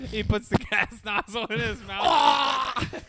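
high, slow, laugh-choked delivery; real laughing. Real, not acted. Joking to friends about the gas pump, laughing. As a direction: crack up while getting the words out. Cracking up during the bit.

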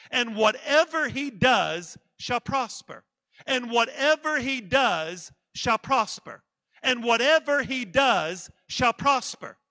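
loud, slow, driving repetition of scripture; emphatic preaching. Real, not acted. Preacher drives the last sentence home to the church, loud and emphatic. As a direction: hammer the verse home, pausing before the last words.

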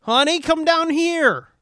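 calling out. Pretend. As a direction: call sweetly down the stairs. Voicing character calling downstairs.

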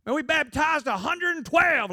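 high, loud, slow boast; boastful pastor buddy. Retold or imitated. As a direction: crow about the baptism count like a show-off preacher. Pastor buddies bragging about numbers.

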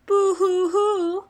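mock boo-hoo sobbing, slow; hammed-up pretend crying; fake crying. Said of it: "you didn't have to do the boo-hoos".

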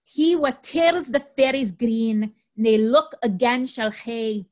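slow, measured Scottish rhyme in dialect; Scottish accent. Shown as a demonstration. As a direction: recite it with a wandering amateur Scottish accent. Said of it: "read it in a Scottish accent".

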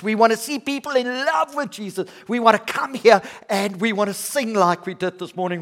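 loud, high, ringing preacher's rally; rousing vision-casting. Real, not acted. Pastor casting the church's vision to the congregation, rousing and loud. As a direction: build with fervour, hammering each "we want".

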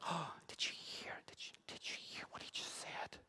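whispered scandalised gossip; gossipy whisper. Acted. Pharisees whispering to each other, scandalised. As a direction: breathe it like passing on a scandal. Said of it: "they're there being like".

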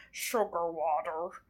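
slow, soft, strange bug alien; creepy alien voice. Retold or imitated. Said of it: "I did a pretty good job doing the impression".

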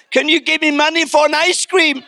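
put-on high, pleading child voice; hammed-up timid request from a cowering boy; mock fearful son. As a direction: beg humbly, as if unworthy to ask. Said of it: "he was so afraid of the, the response".

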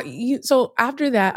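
normal talking voice

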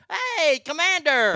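high, loud call to a dog. Pretend. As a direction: shout the dog's name across the hall. Staffer calling the dog.

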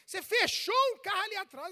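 loud, high accusation; imitated angry policeman. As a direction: accuse the driver forcefully. Policeman scolding the wife.

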